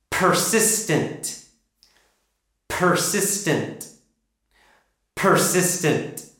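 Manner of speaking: Persistent slowly with a strongly aspirated P; slow exaggerated Persistent. Shown as a demonstration. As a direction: stretch out Persistent, popping the P. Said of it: "Make it very strong".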